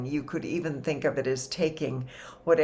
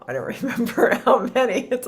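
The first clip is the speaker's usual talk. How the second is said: higher, louder and quicker through a laugh; 10 semitones higher, 9 dB louder, faster than usual. Real, not acted. Speaker admits a memory lapse to the group, laughing. Amused at forgetting the year count.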